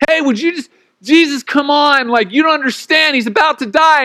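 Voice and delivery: high, loud desperate appeal; acted panicky parent's plea; frantic pleading. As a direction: beg as if nobody understands the danger. Acts out father begging Jesus.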